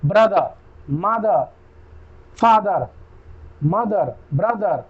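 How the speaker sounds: ब्रदर, मदर, फादर said slowly, the last र dropped; final r silent. Shown as a demonstration. Teacher showing students the silent r, slowly. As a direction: drop the r at the end of ब्रदर and मदर. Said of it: "silent हो जाता है".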